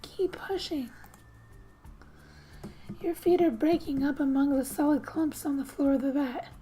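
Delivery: ordinary talk, nothing marked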